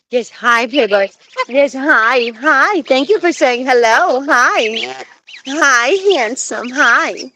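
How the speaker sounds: sing-song pet talk, loud and high; excited pet greeting. Real, not acted. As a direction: greet a beloved pet with delight. Greeting pet bird eagerly.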